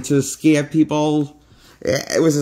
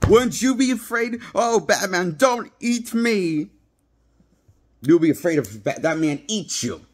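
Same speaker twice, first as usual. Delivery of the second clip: strained, higher-pitched spoof of a scary Batman; hammed-up Batman menace, pitched up; mocking Batman voice; 7 semitones higher than usual. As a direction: taunt like a silly superhero trying to scare. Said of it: "That's what his Batman voice sounds like".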